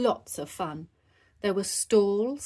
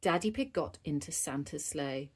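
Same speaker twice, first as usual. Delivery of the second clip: ordinary delivery, nothing marked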